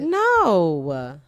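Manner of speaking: loud drawn-out denial, voice jumping up; voice going up. Real, not acted. Denying she uses people's pain, voice going up. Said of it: "Why your voice go up though?".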